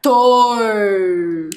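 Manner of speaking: "Tor" held very long into the final r; sounded-out syllable. Shown as a demonstration. Teacher answering which syllable is first, "Tor" drawn out. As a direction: stretch out "Tor" slowly.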